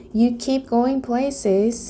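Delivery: slow, sulking complaint; sulky dragon. A narrator's reading. Dragon complains to Luna, slow and sulky. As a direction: mope without meeting her eye. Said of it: "he said, without looking".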